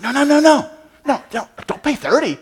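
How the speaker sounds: lightly voiced tourist's quick no, no, no; a hint of a warning neighbor; tourists warning. Tourists next to him warning him not to pay, lightly voiced. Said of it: "other tourists are going".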